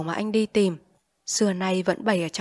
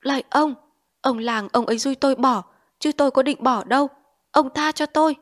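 higher, louder terrified pleading, building; desperate begging; 6 semitones higher, 5 dB louder than usual. Acted. Addict begging an imagined figure not to hit him, desperate pleading. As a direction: beg for mercy as if being beaten. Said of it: "van lơn".

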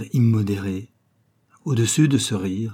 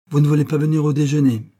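raised, louder exclaimed question; 5 semitones higher, 5 dB louder, faster than usual. A narrator's reading. Young man refused a lunch invitation, exclaimed. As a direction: cry out the question, higher and louder. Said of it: "s'écria-t-il".

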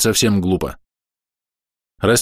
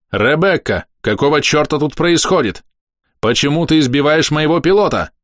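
higher-pitched angry questioning; cross, raised tone short of shouting; 6 semitones higher than usual. A narrator's reading. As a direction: demand an explanation with controlled anger. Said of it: "на повышенных тонах зло спросил я".

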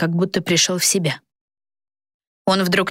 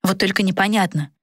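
ordinary delivery

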